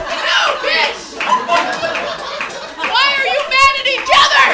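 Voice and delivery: high, loud mock outrage; mock exasperated yell. Comedian to the couple, high loud exasperated yell. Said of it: "And I'm like".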